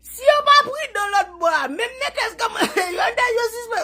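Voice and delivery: high and loud, fast through laughter; laughing hard. Real, not acted. Mocking the rivals in Creole while laughing, high and loud. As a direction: rattle it off while cracking up.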